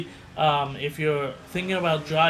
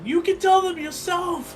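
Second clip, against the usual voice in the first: very high dramatic movie line; hammed-up heroic reply; 13 semitones higher than usual. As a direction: overplay the brave rescuer. Acting out sinking ship farewell.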